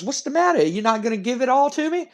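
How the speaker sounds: high, teasing put-on voice; over-the-top wrestler. Wrestler to reluctant tag partner, teasingly hammed-up. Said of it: "And dude goes".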